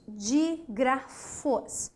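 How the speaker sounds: Dígrafos said very slowly and deliberately; slow emphasis. Shown as a demonstration. Teacher stresses the new term Dígrafos slowly. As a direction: draw Dígrafos out for attention. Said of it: "preste muita atenção nisso".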